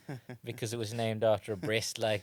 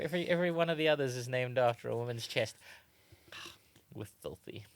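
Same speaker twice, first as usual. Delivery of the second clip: ordinary delivery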